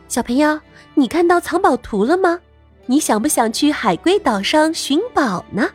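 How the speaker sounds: usual speaking voice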